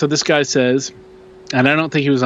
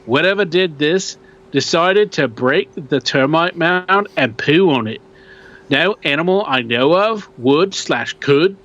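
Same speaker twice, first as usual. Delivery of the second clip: put-on Australian accent; 4 semitones higher than usual. Host reading a Yowie sighting post in a mock Australian accent. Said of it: "I think I'm getting better".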